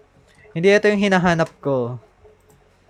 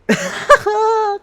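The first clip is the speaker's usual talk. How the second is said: nervous laugh; 4 dB louder than usual. Real, not acted. Nervous about the pull.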